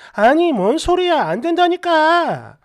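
acted annoyance, slow and emphatic; angry refusal. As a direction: cut the friend off firmly, stretching the words. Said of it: "너무 화가 나가지고".

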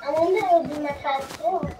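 ordinary talk, nothing marked

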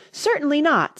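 high, loud, offended Alice; indignant. A narrator's reading. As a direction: refuse with ringing indignation. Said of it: "said Alice indignantly".

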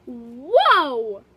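loud delighted whoa; loud amazed child. Acted. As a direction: gasp it out in wonder. Pile of money appears.

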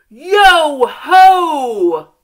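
loud, high "Yo ho!" yell; big hearty pirate yell; pirate shout. Acted. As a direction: belt it out like a pirate crew. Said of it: "They shouted".

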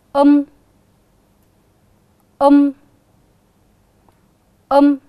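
loud, clear model of the rhyme um; drawn-out um. Shown as a demonstration. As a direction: say um loudly and clearly for the class to copy. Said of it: "Các con cùng đọc". (đọc mẫu vần um to, rõ)

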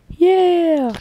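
loud, high, happy yell; excited cheer. Real, not acted. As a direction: cheer it out big. Cheering for his new mic.

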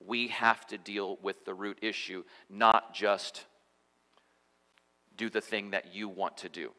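soft, gentle reassurance; doctor gently calming his patient. Acted. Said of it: "And very gently".

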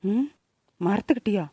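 low, soft musing to oneself; hushed aside. Acted. Woman puzzling to herself about the bushes, hushed aside. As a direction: wonder it under your breath.

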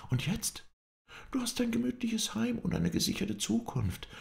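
high-pitched, indignant woman's voice; Scarlett, defiant boasting. Acted. Scarlett defends her money to Ashley, high and indignant. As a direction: throw her success in his face.

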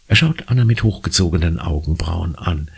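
ordinary delivery, nothing marked

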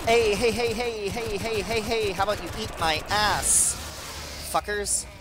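high, loud string of heys; taunting shout. Real, not acted. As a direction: heckle the enemies, loud and high. Taunting enemies in a game.

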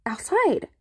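amazed outcry. Retold or imitated. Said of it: "I was like".

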